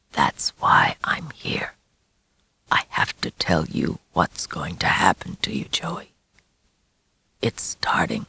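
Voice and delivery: low, breathy hushed whisper. A narrator's reading. Werewolf father whispering the boy's fate into his ear. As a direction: whisper it like a curse. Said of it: "whispering Joey's future relentlessly".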